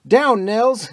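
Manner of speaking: loud, high warning shout; sharp loud cry to duck; urgent yell. A narrator's reading. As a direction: bark the warning before the bomb. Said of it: "he yelled".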